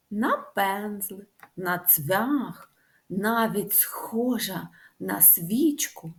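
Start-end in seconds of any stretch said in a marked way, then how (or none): none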